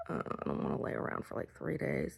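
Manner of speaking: low, soft, hurried grumble; mock groan of reluctance, kept low; weary grumble. Complaining about cramps and lying around, weary low grumble.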